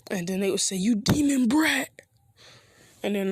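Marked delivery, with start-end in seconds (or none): 0.8-1.9 raised, drawn-out mock insult; mild angry jeer. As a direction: jeer it higher, half in earnest. Villagers insult the boy in story.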